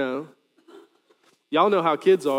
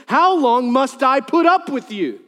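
frustrated rebuke; 8 semitones higher, 6 dB louder than usual. Acted. Jesus rebuking the faithless crowd, loud and exasperated. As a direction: drag it out, fed up with them. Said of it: "He gets frustrated".

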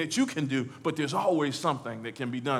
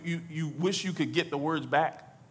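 normal talking voice

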